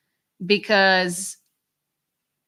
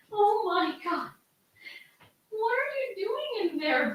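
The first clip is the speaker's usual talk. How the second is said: high, soft coaxing voice to a frightened dog; worried pet talk; 10 semitones higher, 5 dB quieter than usual. Real, not acted. Owner asks her shaking dog what's wrong, high anxious coo. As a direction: coo anxiously at your pet, much higher and softer.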